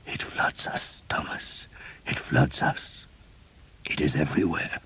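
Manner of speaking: a weak, breathy whisper of a dying man; hushed dying priest. A narrator's reading. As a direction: whisper it, barely any strength left.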